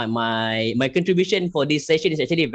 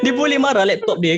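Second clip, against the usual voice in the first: laughing; 10 semitones higher, 3 dB louder, faster than usual. Real, not acted. Repeating the joke, cracking up.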